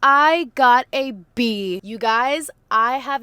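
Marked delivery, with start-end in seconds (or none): 0.0-1.8 excited. Real, not acted. Announcing her test grade.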